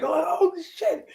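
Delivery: very high-pitched, hammed-up shock; mock overreaction. Podcaster mimicking the show overreacting to small stuff, mock overreaction. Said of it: "This whole show is overreacting to".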